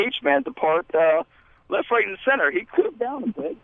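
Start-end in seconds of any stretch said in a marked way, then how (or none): 2.5-3.6 muffled voice. Acted. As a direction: voice through a wall. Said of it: "You're muffled again".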